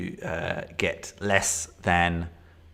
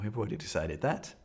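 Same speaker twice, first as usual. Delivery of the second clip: ordinary delivery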